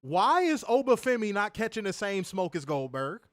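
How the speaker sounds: normal talking voice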